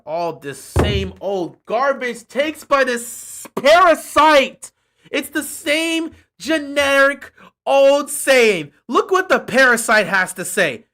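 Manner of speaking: loud, drawn-out disgust; exasperated rant. Real, not acted. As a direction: spit out each word with scorn, slow and loud.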